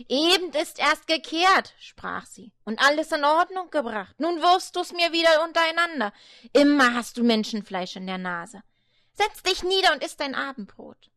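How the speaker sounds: high, loud scolding grandmother. Acted. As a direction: tell him off for the mess. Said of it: "Die Ellermutter schalt ihn aus".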